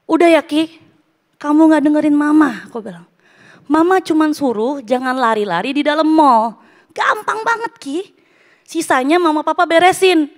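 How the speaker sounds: mother retelling how she scolded her son; retold angry scolding. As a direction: scold the child in exasperation. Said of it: "Aku marah banget".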